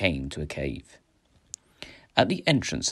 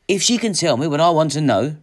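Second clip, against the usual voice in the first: loud, raised, resolute voice; determined reply; 8 semitones higher, 8 dB louder than usual. A narrator's reading. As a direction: insist firmly over their warnings. Hero answers the warning, resolute.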